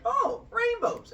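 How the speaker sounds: high, slow, dismissive cannibal voice; mock cannibal. Cannibal tossing organs aside, high slow mock voice. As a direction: toss it off in a high silly voice. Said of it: "they'll go like".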